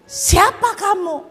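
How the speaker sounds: high, loud, drawn-out demand; startled challenge. Retold or imitated. Young man to the mysterious voice, startled shout. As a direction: call out to an unseen stranger.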